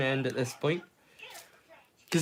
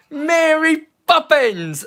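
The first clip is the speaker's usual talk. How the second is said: Mary Poppins stretched slow, much higher, in a bad British accent; mock bad movie British accent, drawn-out Mary Poppins; 10 semitones higher, 5 dB louder, much slower than usual. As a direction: ham up a terrible film British accent, drawn out. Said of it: "That's such a bad British accent".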